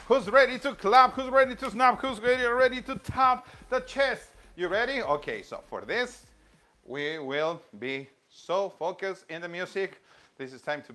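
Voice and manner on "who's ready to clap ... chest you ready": loud, high call to join in; energetic, raised-voice cheerleading; excited rallying. Real, not acted. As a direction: fire the class up with each question.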